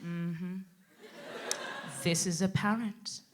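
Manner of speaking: soft, low deadpan; understated, withering reply; dry acknowledgement. Retold or imitated. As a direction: answer quietly, unimpressed. Her dry reply to colleague.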